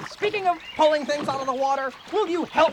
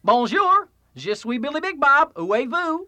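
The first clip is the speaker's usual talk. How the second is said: Texan French; 2 semitones higher, 4 dB louder than usual. Acted. A Texan caller on the phone, in Texan-accented French. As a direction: speak French like a Texan cowboy. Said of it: "speak French like a Texan".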